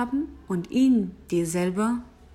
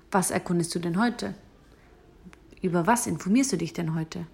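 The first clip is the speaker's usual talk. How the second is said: normal talking voice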